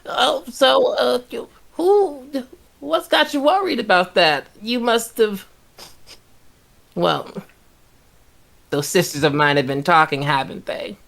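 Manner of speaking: high, sweet sly hag voice. Acted. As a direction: purr it like a knowing hag.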